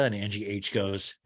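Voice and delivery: usual speaking voice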